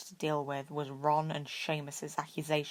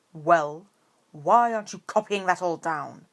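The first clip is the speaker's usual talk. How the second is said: sharp scold; 7 dB louder than usual. A narrator's reading. Professor scolding class.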